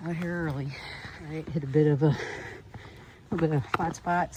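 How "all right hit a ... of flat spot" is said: panting between words; heavy breathing from the climb. Real, not acted. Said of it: "Sorry, I'm breathing heavy".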